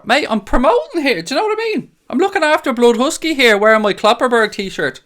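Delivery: high, excited sponsor shout-out; over-the-top promo voice; hyped up. Real, not acted.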